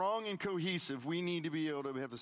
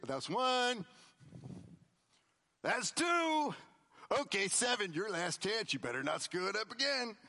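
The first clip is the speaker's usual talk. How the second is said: mock scorekeeping; 5 semitones higher than usual. Mock counting forgiveness.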